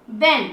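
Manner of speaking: बैन as a brief, low "ben"; very short sound. Shown as a demonstration. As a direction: keep the vowel in बैन short. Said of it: "बिल्कुल short". (बैन छोटी आवाज़ में)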